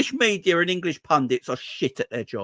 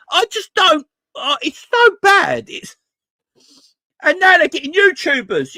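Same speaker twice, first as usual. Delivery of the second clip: high, loud despairing complaint; exasperated; 8 semitones higher, 4 dB louder than usual. Real, not acted. Fan lost for words over bad pundits, exasperated. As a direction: drag out the complaint at the top of your voice.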